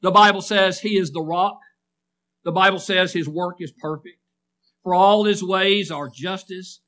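rising and falling preacher's cadence; preaching. Acted. Preacher to his congregation, rhythmic preaching.

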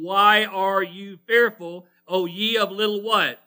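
loud, slow quotation of a rebuke; emphatic pulpit challenge; preached rebuke. Retold or imitated. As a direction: drive each word home slowly. Quotes Jesus rebuking disciples.

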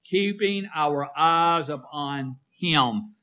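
Keeping our eyes upon Him drawn out slowly, every word weighted; slow emphatic refrain. Shown as a demonstration. Preacher leading the congregation in a refrain, slow and emphatic. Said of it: "Say that with me".

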